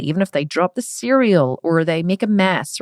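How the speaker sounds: normal talking voice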